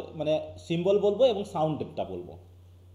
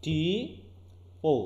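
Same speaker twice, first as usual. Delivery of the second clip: syllables spelled out; 4 dB quieter, much slower than usual. Shown as a demonstration. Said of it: "এই তিনটা syllable".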